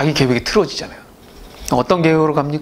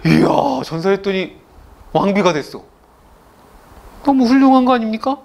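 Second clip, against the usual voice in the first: admiring onlookers; 7 semitones higher than usual. Retold or imitated. Onlookers praising the king.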